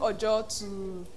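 usual speaking voice

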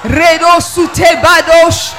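loud, slow ecstatic tongues; praying in tongues. Real, not acted. Pastor praying in tongues over the congregation, loud and fervent. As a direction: pour out tongues at full force.

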